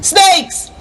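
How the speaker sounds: high-pitched warning yell; alarmed yell. A narrator's reading. Gang member warns the others of rivals, yelling. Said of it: "one of the K-Bones yells".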